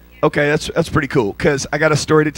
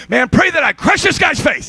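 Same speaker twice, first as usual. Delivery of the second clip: quoted fighter's shout; 10 semitones higher than usual. Retold or imitated.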